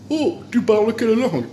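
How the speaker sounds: very high-pitched, put-on surprised visitor; mock astonishment. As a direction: ask it in exaggerated squeaky amazement. Visitor astonished by the child's French. (étonnement feint, voix suraiguë)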